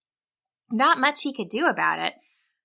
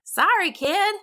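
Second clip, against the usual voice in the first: higher, folksy camp cook voice; Flapjack Fran; 9 semitones higher than usual. A narrator's reading. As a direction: sympathetic cook letting him down. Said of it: "Flapjack Fran said to him".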